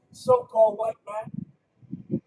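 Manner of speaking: very slow, each word stretched out; drawn out. Acted. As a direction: lean on it slowly, letting every word land. Preacher stretches the phrase out.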